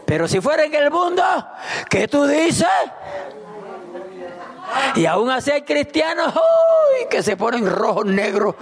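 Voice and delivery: fiery pulpit shout; preaching at full force. Acted. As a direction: rouse the congregation with a shout.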